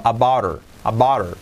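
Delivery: "about her" run into one word, h dropped; said fast, linked. Shown as a demonstration. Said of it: "Dicho rápido". ("about her" dicho rápido, todo unido)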